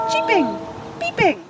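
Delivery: high cheeping voice; a hint of high baby-bird cheeping; chick cheeping. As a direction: sound like tiny chicks. Baby birds hatching.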